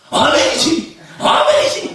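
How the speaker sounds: loud excited amen with a laugh; shouted amen. Retold or imitated. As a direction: call out amen joyfully. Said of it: "그러신다고 이분이?". (신나게 외치는 아멘)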